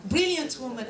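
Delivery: ordinary delivery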